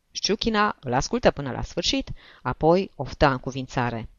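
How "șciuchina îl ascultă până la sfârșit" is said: ordinary delivery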